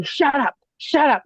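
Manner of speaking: high, sharp mock yell of an old man, same loudness; retold shout. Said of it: "like, over and over".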